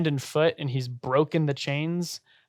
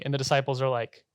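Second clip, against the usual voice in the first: normal talking voice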